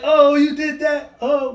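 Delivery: high, slow, stretched out "oh, you did that"; mock amazed parent. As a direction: play up being wowed by the baby. Parent reacting to baby's trick.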